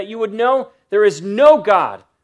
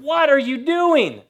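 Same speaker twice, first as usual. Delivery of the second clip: higher, slow, half-acted outrage; indignant outcry; 6 semitones higher than usual. Apostles rebuking the worshipping crowd, mock indignant. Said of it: "tore their clothes in anger and said".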